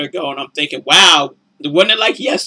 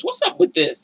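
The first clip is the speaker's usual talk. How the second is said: suspicious. Retold or imitated. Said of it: "I'd be thinking".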